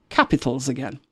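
ordinary talk

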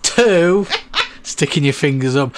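loud mock tourist order; British tourist. Co-host imitates Brit ordering in Spain, mild loud mock. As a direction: overpronounce it for the waiter. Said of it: "speaking very slow English very loudly".

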